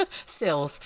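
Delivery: sills, the Kiwi e in cells close to i. Shown as a demonstration. Reader echoing how chat hears her Kiwi cells. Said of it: "isn't it cats who sleep on windowsills".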